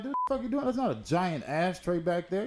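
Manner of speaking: very high, squeaky disbelief, then slowing down; mock outraged squeal at a friend in the truck; incredulous retold yell. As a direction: pitch it way up like you can't believe your eyes. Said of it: "I'm like".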